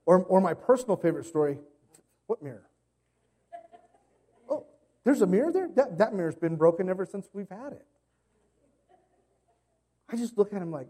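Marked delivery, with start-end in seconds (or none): 2.3-7.9 "there's a mirror there?" jumps high in fake surprise; mock innocent surprise. Kid caught by dad acting shocked, mock innocent surprise. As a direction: play dumb, pitch leaping up in feigned shock.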